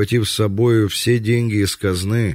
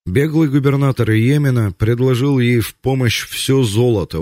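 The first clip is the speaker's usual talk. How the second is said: ordinary talk, nothing marked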